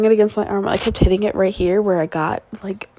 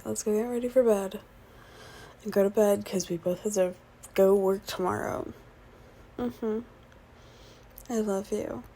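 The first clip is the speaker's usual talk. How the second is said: much softer, intimate goodnight; soft and tender; 11 dB quieter than usual. Real, not acted. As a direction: murmur it gently to a partner. Saying goodnight to partner.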